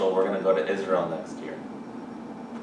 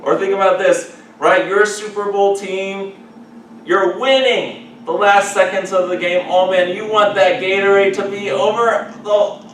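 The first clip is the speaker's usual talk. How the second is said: excited preaching; 10 semitones higher, 10 dB louder than usual. Real, not acted.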